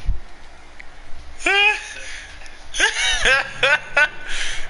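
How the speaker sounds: high breathy laugh; wheezy laugh. Real, not acted. Laughing at his own joke about Cedric, high unvoiced laugh.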